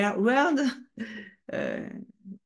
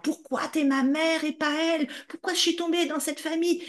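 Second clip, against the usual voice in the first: despairing shout; 10 semitones higher than usual. Retold or imitated. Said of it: "Je disais".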